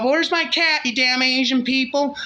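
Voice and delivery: a high, loud, frantic yell for a lost cat; yelling out the window. Acted. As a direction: holler it out the window at the neighbors. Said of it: "yelling out the window".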